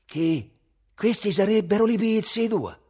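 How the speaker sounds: high, loud scornful question; grimacing indignation. A narrator's reading. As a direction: throw it back in loud disbelief. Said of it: "caricò di più la smorfia".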